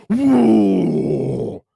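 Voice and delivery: high, loud excited whoa; excited whoop. Acted. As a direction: whoop like a hyped-up orc. Ork cheer after chant.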